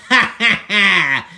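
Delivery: loud mocking dragon laugh; villain laugh. Dragons gloating over unicorns, loud villain laugh.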